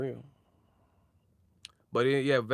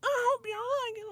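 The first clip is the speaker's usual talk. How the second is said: high falsetto squeak; squeaky media voice. Pretend. Playing the media guy in bed, squeaky falsetto. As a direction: say it in a tiny mousy voice.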